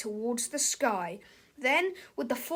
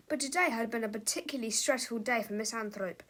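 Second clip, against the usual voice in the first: ordinary delivery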